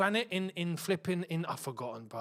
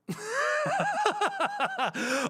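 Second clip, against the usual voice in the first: laugh. Real, not acted. Streamer reacts to Saudi light show.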